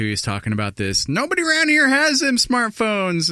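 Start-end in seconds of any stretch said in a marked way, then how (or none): 1.1-3.3 much higher, put-on rural twang; mock a backwoods caller. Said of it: "Somebody's just calling into this radio show".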